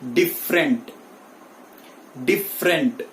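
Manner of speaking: 'डिफरेंट' in two syllables, dif-rent; right pronunciation. Shown as a demonstration. Teacher has students repeat, right pronunciation dif-rent. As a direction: model 'डिफरेंट' with a silent middle vowel. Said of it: "मेरे साथ बोलिए".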